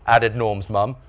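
ordinary delivery, nothing marked

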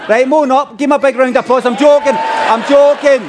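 high, loud calling out to the crowd; mock applause demand. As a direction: shout over the audience, playfully.

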